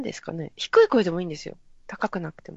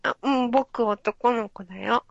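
put-on higher, slow boyish voice; mock young boy; 7 semitones higher than usual. Playing a young boy answering a pushy question. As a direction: answer like a shy little boy.

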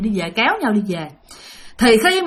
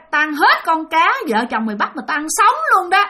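higher-pitched ghost boast; lightly voiced spirit, pitch raised; possessing spirit; 6 semitones higher than usual. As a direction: repeat it as the demanding ghost. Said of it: "Nói vậy đó".

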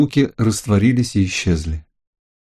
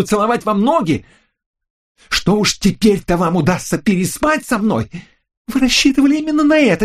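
furious and menacing; 12 semitones higher than usual. A narrator's reading. Lucille accuses the narrator of breaking the door, furious shout. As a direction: spit it out, furious and ominous. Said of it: "Голос звучал яростно и зловеще".

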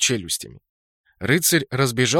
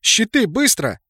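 higher, louder barked order; urgent command; 9 semitones higher, 4 dB louder, slower than usual. Acted. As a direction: snap the order in a hurry. Commander orders tanks forward.

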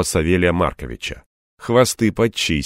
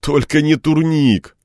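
higher, slow, drawn-out groan; groaning plea; 8 semitones higher, slower than usual. A narrator's reading. Man begs assistant to spare him pull-ups, groaning. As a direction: plead with a mild groan. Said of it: "простонал я".

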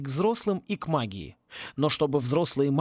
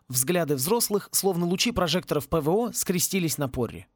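usual speaking voice

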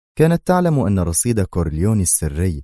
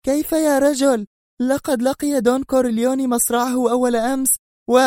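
very high enraged cry; shrill outraged outburst; furious scream. A narrator's reading. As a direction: scream at him in fury. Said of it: "ثم صرخت في ثورة".

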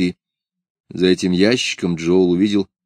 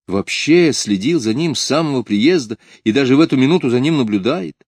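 usual speaking voice

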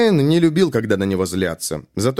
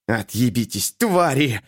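hissing under breath; slower than usual. A narrator's reading. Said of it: "шипел он себе под нос".

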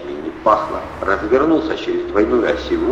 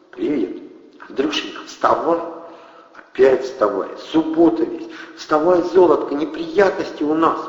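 urgent wake-up call; 7 semitones higher than usual. A narrator's reading. Kristina wakes Andrey urgently.

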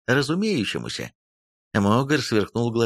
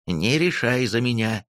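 ordinary delivery

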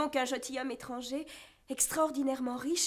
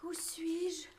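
faint whisper; 7 dB quieter than usual. Acted. Waking from faint.

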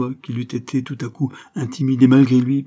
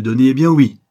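loud, raised burst of admission; defiant outburst; 4 semitones higher, 10 dB louder than usual. A narrator's reading. Banker admitting it to the countess, defiant outburst. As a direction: fling it out loudly.

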